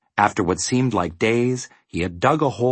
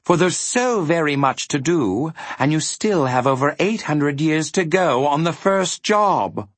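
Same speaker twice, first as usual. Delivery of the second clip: lightly voiced high-pitched villain; a hint of gloating demon; menacing; 7 semitones higher than usual. A narrator's reading. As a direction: press them with mild menace. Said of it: "he ordered with a menacing".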